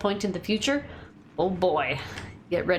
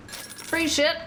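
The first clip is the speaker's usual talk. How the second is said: excited; 10 semitones higher than usual. Real, not acted. Streamer grabs free loot again.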